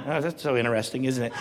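usual speaking voice

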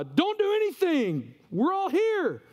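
high-pitched urgent call across a room; acted shout of reassurance, held high; calling out to reassure. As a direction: call out to stop someone, pitched well up. Paul calls out to the jailer.

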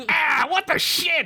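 high, drawn-out startled cry; startled shout. A narrator's reading. Peppercorn stung by screeching earstone, high startled shout. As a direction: cry out high, without much added volume. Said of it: "he shouted".

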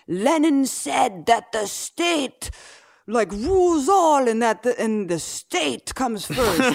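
put-on high, slow old man's voice; pompous old party man lecturing; cane-tapping official quoting Lenin, high and slow; old Soviet official. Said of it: "taps his cane and he's like".

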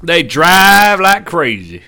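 slow, loud, drawn-out mock drawl; mock American. Answering what the Americans do, mock American. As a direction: stretch it out as a send-up of Americans.

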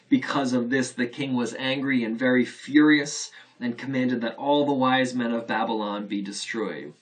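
loud, formal Bible reading; proclaiming the verses loudly; scripture reading. Acted. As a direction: read it out to the congregation with weight.

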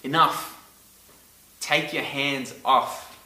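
firm, emphatic command, slowed on take your hands off. Shown as a demonstration. Preacher voicing God, firm and emphatic. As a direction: tell them to stop, firmly. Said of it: "can be translated to mean stop".

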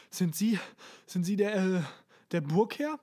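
winded, broken-up question; out of breath. A narrator's reading. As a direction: ask it in short bursts, puffed from climbing stairs. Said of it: "offensichtlich außer Atem". (außer Atem, stockend)

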